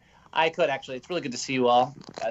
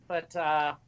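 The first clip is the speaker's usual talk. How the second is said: usual speaking voice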